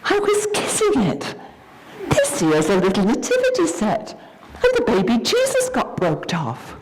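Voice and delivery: put-on a small girl defending herself to mummy; child insisting she was only kissing it; little girl voice. Child explains to her mother.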